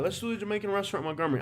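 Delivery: normal talking voice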